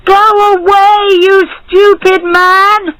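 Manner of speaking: high, loud, furious yell; angry shout. A narrator's reading. Faisal in the box to the barber, shouting angrily. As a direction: send him off with a drawn-out shout. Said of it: "he shouted".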